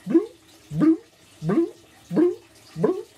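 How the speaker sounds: high, rising bloop for each hop; little bunny-hop sound effects; high bloop noises. Acted. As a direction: make short upward bloops. Imitating bunnies hopping into boat.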